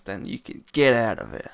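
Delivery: hint of tough-guy American; American accent. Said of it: "I'm doing my American accent again".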